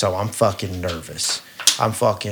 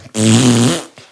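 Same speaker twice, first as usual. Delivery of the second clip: imitated wheezy laugh; 10 dB louder than usual.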